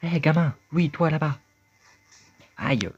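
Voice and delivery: high, loud bossy character voice; a cranky little creature ordering the kid to help; impatient talking hat. Acted. Talking hat scolding the boy to help him, high and loud.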